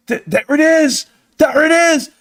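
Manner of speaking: high, gleeful there it is; excited high exclaim. Retold or imitated. Alone at home cracking the puzzle, high gleeful cry. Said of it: "And I'm just like".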